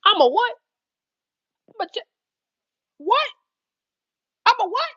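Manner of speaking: high, rising what?; incredulous, pitch climbing; shocked disbelief. Retold or imitated. Bartender hearing she must check him in, shocked disbelief.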